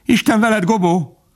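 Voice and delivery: very high parting cry; high, distant farewell; farewell call. A narrator's reading. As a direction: call goodbye while running. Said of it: "s csak egy idő múlva mondta".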